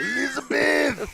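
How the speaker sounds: drawn-out wrestler shout; Macho Man impression. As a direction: call it out like Randy Savage.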